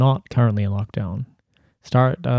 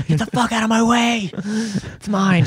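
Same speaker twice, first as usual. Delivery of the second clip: high, hammed-up panicked shout; mock frantic yell; 12 semitones higher, slower than usual. Pretend panic buyer shoving through the supermarket, in a high frantic yell. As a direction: bark it while shoving carts aside.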